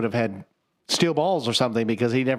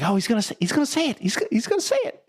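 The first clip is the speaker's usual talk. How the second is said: rising ever higher from excited to squealing; building excitement; 11 semitones higher than usual. Retold or imitated. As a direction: climb into a giddy squeal. Deadpool anticipates Cap's line.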